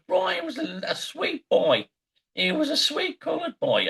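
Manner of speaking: high-pitched mother voice; mock mum in a high voice; Howard Stern's mother. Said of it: "Howard Stern's mother called up the show and goes".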